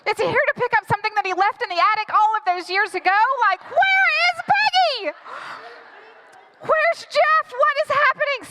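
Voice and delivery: high-pitched, escalating panic; hyperventilating panic. Acted. Girlfriend dreads divorced parents meeting, panicking. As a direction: spiral upward into hammed-up freak-out. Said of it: "I start hyperventilating".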